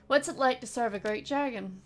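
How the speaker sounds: ordinary talk, nothing marked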